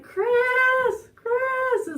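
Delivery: high, drawn-out holler of a name; loud, high-pitched distance call; calling loudly. Retold or imitated. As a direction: re-enact yelling to someone far away. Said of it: "as loud as I can".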